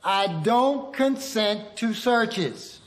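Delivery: slow, deliberate demonstration; slow model line. Shown as a demonstration. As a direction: spell each word out slowly for them. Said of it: "Everyone, repeat after me".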